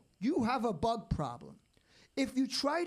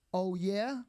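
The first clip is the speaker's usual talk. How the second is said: normal talking voice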